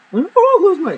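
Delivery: high whining protest; whiny refusal. Retold or imitated. Girlfriend whines back, refusing to close her eyes. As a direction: whine it like a kid.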